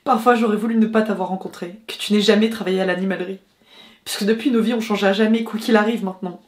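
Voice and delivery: acted distressed outpouring to a lover; film monologue. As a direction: let the regret rise, then break into halting fragments. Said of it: "voyons si j'arrive à recréer cette sensation".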